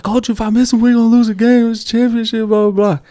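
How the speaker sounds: put-on high, whiny little kid pleading; crying kid voice. Coach imitates his crying player, high and whiny. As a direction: mimic a scared crying third grader. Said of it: "And he's like".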